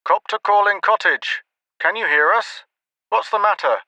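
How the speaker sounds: high radio call; a worried voice over the radio; squawking radio. A narrator's reading. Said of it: "The radio continued to squawk".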